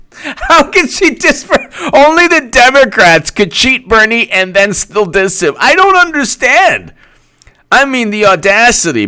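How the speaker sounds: be scandalized on his behalf; mock outrage. Mock outraged Bernie fan about the insult.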